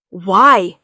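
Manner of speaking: sharp loud "Why"; demanding. A narrator's reading. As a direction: demand an answer. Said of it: "Singh demanded".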